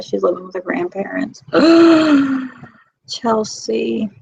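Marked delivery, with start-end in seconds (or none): 1.5-2.6 loud, high gasp; sharp gasp. Real, not acted. Hearing of someone's troubles, a loud gasp. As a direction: suck in a long, loud breath. Reacting to sad news being shared.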